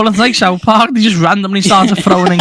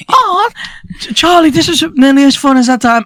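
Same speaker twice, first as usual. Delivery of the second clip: high-pitched delighted exclamation; excited character; 8 semitones higher than usual. Acted. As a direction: call to a friend, mock-thrilled and high. Acting theme park characters to Charlie.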